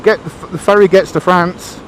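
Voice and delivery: normal talking voice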